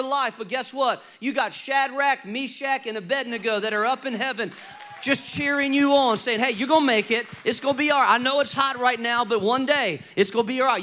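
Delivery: loud, rising sermon build, every line pushed hard; full-force preaching. Acted. Preacher rallying the congregation, driving the build hard and loud.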